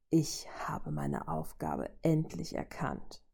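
slow, soft villain undertone; self-assured rumble. A narrator's reading. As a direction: rumble it quietly, sure of yourself. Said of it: "grollte er selbstsicher".

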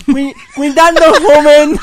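very loud, high mock nasal warning; nasal passenger imitation. Friend imitates the nasal woman warning the man, high and very loud. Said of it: "Y la gangosa le dice".

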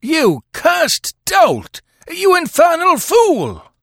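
slow, high, loud fury; furious, drawn-out abuse; storming. A narrator's reading. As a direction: spit out each insult at full force. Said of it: "stormed the detective".

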